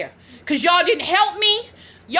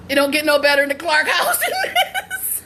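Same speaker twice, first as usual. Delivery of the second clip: usual speaking voice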